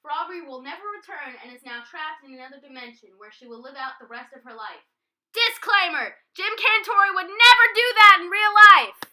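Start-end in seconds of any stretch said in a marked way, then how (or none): 5.3-9.0 much higher, far louder announcement; shouted disclaimer. Real, not acted. Presenter shouting a disclaimer to the audience. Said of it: "shouted disclaimer".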